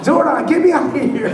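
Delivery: high, loud hammed-up hero cry; pleading to Zordon in a hammed-up high, loud voice; mock superhero plea. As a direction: ham up a cartoon hero begging for rescue. Mock Power Rangers cry.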